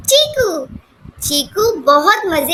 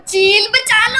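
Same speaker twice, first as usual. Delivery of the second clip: mock cry for help; 4 semitones higher than usual. Kite bird swooping, calls for help.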